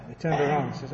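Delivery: ordinary talk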